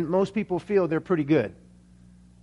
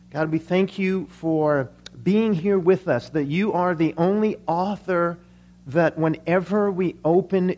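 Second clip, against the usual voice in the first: prayer; 3 semitones lower than usual. Acted. Pastor thanking God before the sermon, prayer. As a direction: pray aloud, low and unhurried.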